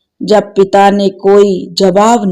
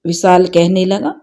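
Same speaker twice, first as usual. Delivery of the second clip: plain reading voice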